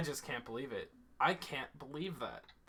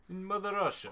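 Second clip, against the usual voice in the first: higher, put-on Russian accent; mock Russian; 5 semitones higher than usual. Annoying a friend with mock Russian. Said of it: "is the Russian accent".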